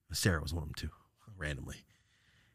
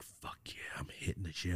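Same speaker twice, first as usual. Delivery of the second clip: hushed, whispery inner voice; soft, low aside of self-encouragement; private thought; 3 semitones lower, 13 dB quieter than usual. Retold or imitated. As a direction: mutter it to yourself under your breath. Voicing own thoughts mid-fight.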